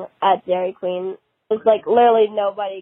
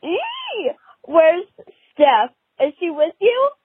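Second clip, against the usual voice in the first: eager church lady; 7 semitones higher than usual. Retold or imitated. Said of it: "this lady literally ran up to us, and she was like".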